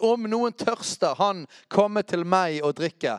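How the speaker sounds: slow, emphatic call, short of a shout. Acted. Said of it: "Jesus sto og ropte ut".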